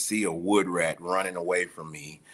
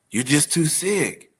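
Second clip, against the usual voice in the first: louder, higher, hammered home; firm louder verdict; 6 semitones higher, 6 dB louder than usual. Retold or imitated. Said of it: "He was like".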